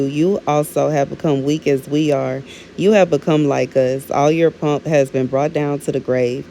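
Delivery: ordinary talk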